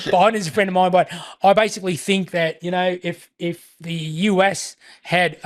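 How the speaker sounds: Australian politician impersonation, measured and halting; Australian accent, easing down at the end; John Howard's Australian accent. Acted. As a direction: do an Aussie elder statesman's accent. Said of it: "props for the Australian accent".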